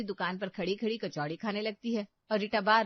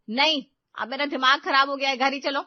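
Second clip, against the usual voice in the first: loud, huffy refusal; irritated and forceful; annoyed outburst; 3 semitones higher, 9 dB louder than usual. A narrator's reading. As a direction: call it off loudly, fed up. Offended sister-in-law calls off shopping.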